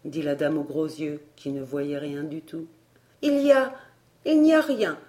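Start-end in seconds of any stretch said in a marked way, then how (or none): 3.2-5.0 high, louder, halting answer; embarrassed. A narrator's reading. Elsie covering up what she saw, embarrassed. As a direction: break off, then cover it up awkwardly. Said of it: "répondit Elsie embarrassée".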